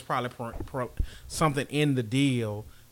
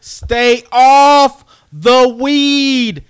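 very loud, high, drawn-out warning; loud scolding; 8 semitones higher, 15 dB louder, much slower than usual. Real, not acted. Co-host warning the other off weed, loud scolding. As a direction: stretch each word out in a booming plea.

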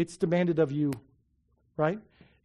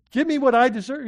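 higher, louder mock demand; hammed-up demand, grabbing the debtor; demanding servant; 6 semitones higher, 7 dB louder, faster than usual. Servant chokes his fellow servant.

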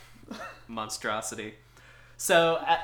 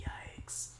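whispered yikes; 13 dB quieter than usual. Real, not acted. Reacting to 9/11 link.

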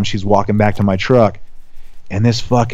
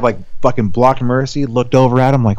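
ordinary talk, nothing marked

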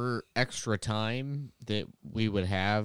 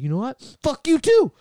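much higher, louder mock yell; defiant outburst; 12 dB louder than usual. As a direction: fire it back at the teacher. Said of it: "I'd be like".

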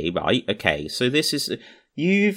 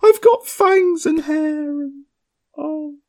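fake crying; 4 dB louder than usual. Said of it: "Oh, boo-hoo".

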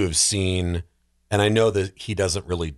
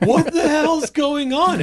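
very high, louder and slow, baffled; bewildered outcry; 5 dB louder, slower than usual. Retold or imitated. Viewer baffled as the movie moves on, high outcry. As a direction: stretch it out in loud disbelief. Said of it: "and you're like".

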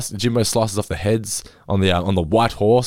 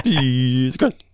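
announcer voice; 3 semitones higher, much slower than usual. Retold or imitated. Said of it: "that's what they do".

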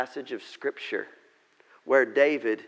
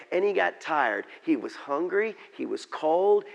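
ordinary talk, nothing marked

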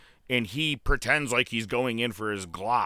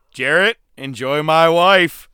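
louder, slow smug taunt; Kurt Angle taunt; 4 semitones higher, 10 dB louder, slower than usual. Retold or imitated. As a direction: gloat over the wife line like Angle. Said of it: "Angle said to Jarrett".

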